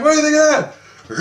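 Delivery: high, loud-ish goading; crowing challenge to a crowd; rousing the crowd. Retold or imitated. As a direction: whip the kids up, pitched high. Rousing the screaming kids.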